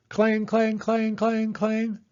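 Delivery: high ringing clang, clang; alarm bell. A narrator's reading. The fire alarm goes off in the truck, high ringing clangs.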